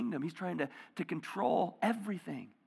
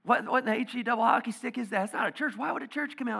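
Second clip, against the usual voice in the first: imitating friend; 7 semitones higher, 5 dB louder than usual. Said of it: "he says that every time".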